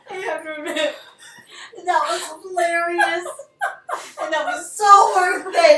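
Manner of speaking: laughing. Real, not acted. Laughing through the mishap.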